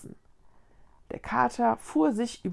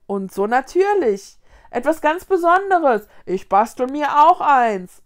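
loud, enthusiastic praise; joining in excitedly; 5 semitones higher, 10 dB louder than usual. A narrator's reading. Other ghosts admire the leaf sheet, excited. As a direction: pipe up loudly and eagerly. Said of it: "stimmten andere ein".

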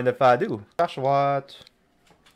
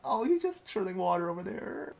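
amazed giggling. Real, not acted. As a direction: marvel through giggles. High soft amazed laughter.

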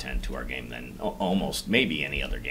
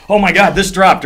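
much higher, loud excited outburst; 16 dB louder than usual. Retold or imitated. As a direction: burst out over a rare drop. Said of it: "I also get very excited".

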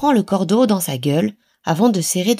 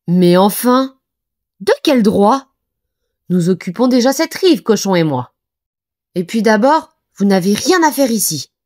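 louder, higher outraged objection; indignant protest; 4 semitones higher, 5 dB louder than usual. Acted. Éléphant et Cochon chassent Castor, protestation indignée. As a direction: defend the territory, offended. Animals quarrel over the riverbank.

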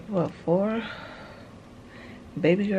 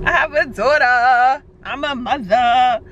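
louder, rising with joy; excited; 3 semitones higher, 10 dB louder than usual. Real, not acted. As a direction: burst out with pride. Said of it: "repeated louder".

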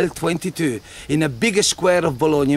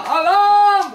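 high, loud cry of alarm; alarm shout; 5 dB louder than usual. Acted. Jester as townsman warning Bologna, loud alarm cry. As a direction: raise the alarm like a town crier.

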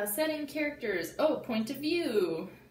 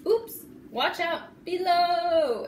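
higher, louder and slow warning call; 7 semitones higher, 7 dB louder, much slower than usual. Acted. Froggy calling down to those below, loud and slow. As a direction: call it down from the treehouse.

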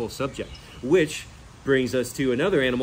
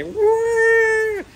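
very high, loud imitated pig squeal; 8 dB louder than usual. Describing the rescue pig's hoof trim, loud high imitated squeal. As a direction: squeal like a pig getting its hooves trimmed. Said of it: "pigs scream".

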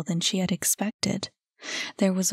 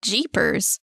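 exasperated exclamation; 2 semitones higher than usual. A narrator's reading. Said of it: "exclaimed Kit".

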